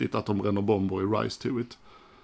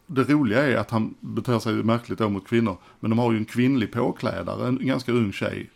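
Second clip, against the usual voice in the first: ordinary delivery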